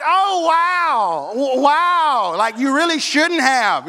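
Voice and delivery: drawn-out disappointed wow, acted; feigned gratitude. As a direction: fake thanks while badly let down. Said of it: "all the Christmas just got sucked out".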